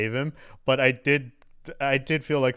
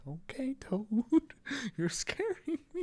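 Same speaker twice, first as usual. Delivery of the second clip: higher, softer, a little uneasy; lightly voiced nervous Luigi; 14 semitones higher, 6 dB quieter than usual. Luigi edging away from creepy Toad, higher and softer. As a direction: back away like a spooked hero. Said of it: "You're just like".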